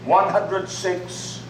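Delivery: normal talking voice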